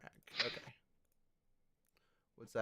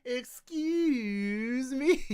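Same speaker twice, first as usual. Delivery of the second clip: very high, slow mock Link voice; Link impression; 11 semitones higher, much slower than usual. Streamer mimicking Link's line for friends, very high. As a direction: stretch it out in a squeaky Link voice. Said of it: "audio recording of that".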